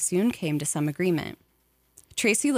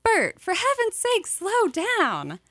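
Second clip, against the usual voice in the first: much higher, pleading cry; frightened cry; slower than usual. A narrator's reading. Mother begging father to slow down, frightened cry. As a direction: beg him to slow down, scared. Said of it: "Mother cried".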